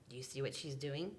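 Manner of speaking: plain reading voice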